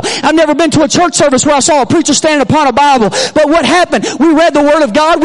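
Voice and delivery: loud, driving preacher's delivery; forceful preaching. Real, not acted. Preacher explaining standing on the Bible, forceful preaching. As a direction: press the testimony home with force.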